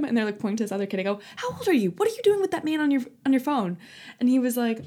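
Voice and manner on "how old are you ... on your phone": high, demanding Karen interrogation; inner Karen. Retold or imitated. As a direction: scold a kid like an outraged Karen. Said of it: "pulled out my inner Karen".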